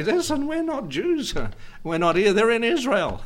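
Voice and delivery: high, mock English royal voice, laughing. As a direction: squeak out an English royal denial. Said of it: "speak up against these people and say".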